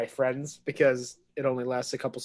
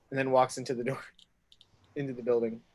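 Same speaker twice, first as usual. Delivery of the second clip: normal talking voice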